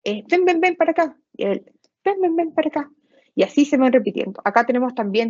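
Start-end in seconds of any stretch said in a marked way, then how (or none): 0.3-1.2 high calling come-here; a parent calling the child over, higher; beckoning call. Shown as a demonstration. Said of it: "Están las ecopraxias que imitan gestos". 2.0-2.9 high calling come-here; a parent calling the child over, higher; beckoning call. Shown as a demonstration. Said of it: "Están las ecopraxias que imitan gestos".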